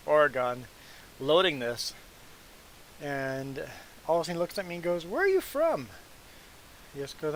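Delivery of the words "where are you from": high-pitched imitation of a puzzled trucker; puzzled questioning. Trucker suddenly quizzing a Canadian at loading, puzzled. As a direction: swoop up high on the retold question.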